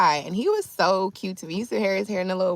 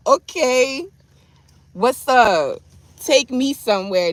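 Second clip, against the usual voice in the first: loud, raised-pitch flirtation; bold flirty comeback; 7 semitones higher, 7 dB louder, slower than usual. Retold or imitated. Woman answering a man who shot his shot, bold flirty comeback. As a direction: answer him loud and high, playing up the interest. Acting her flirty reply to him.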